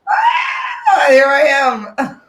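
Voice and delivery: high, drawn-out squeal into a hello; excited stage entrance. Pretend. Comic answering her introduction onto the stage, excited high shout. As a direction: burst onstage with a playful high shout.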